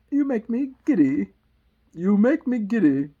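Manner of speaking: high, loud royal complaint; screaming Queen. Acted. Queen scolding the bowing gardeners, loud and high. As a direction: complain at full volume. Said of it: "screamed the Queen".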